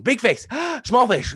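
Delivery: high gasp between the words; big face gasp drill. Shown as a demonstration. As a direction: gasp wide, then shrink to small face. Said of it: "we go back and forth".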